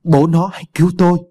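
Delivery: high, loud terrified cry for help; panicked scream. Acted. Wife seeing a bloody ghost child, calling her husband, terrified scream. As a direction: scream for your husband in sheer terror. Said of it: "chị hoảng loạn gào lên".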